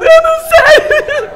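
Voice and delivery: very high, loud, slow wailing; mock wail. Eva fake-cries to God, high slow wail. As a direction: wail it like a hammed-up crybaby. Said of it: "Brincadeira, não foi desse jeito".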